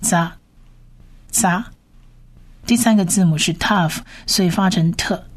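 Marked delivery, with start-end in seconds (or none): 0.0-1.6 "扎" as one short, isolated tsa; Hebrew syllable model. Shown as a demonstration. Teacher combines letter and vowel into one sound, syllable model. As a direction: say "扎" as tsa, pausing between repeats. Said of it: "就是发啊的音是扎".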